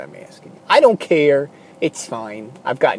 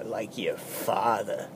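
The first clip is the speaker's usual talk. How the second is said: condescending; 6 semitones lower, 14 dB quieter, slower than usual. Retold or imitated. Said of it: "in a real condescending way".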